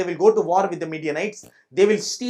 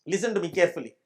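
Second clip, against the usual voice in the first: usual speaking voice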